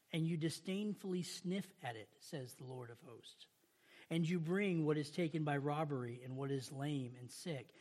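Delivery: measured, solemn Bible reading; scripture reading. Acted. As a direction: read the prophet's words with gravity. Reads Malachi scripture aloud.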